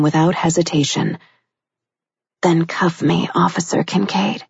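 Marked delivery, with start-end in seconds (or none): 2.4-4.4 husky female voice. A narrator's reading. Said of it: "she said huskily".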